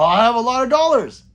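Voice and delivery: loud, high, swelling exclamation; exclaimed punchline. Acted. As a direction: belt out the punchline. Punchline after long pause.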